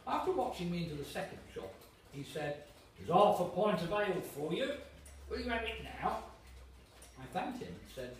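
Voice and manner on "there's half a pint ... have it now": louder, cheery waiter offering a drink; jolly waiter. Acted. Waiter tempting young David with ale, jovial.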